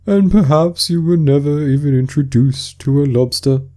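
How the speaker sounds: a weepy sea creature struggling through tears; tearful Mock Turtle. Mock Turtle speaking to Alice, high and tearful.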